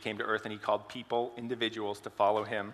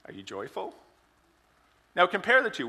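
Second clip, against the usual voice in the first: normal talking voice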